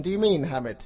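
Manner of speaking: ordinary talk, nothing marked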